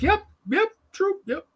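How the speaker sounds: high, sing-song sarcastic yeps; mock-agree in a high squeak; sarcastic agreement. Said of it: "We'll be like".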